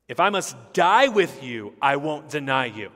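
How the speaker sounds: loud, high, vehement pledge; emphatic. Retold or imitated. Peter vows to die with Jesus, emphatic. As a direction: proclaim loyalty at full force. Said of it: "he said emphatically".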